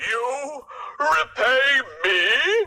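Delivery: mocking laugh. A narrator's reading. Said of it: "the lion laughed".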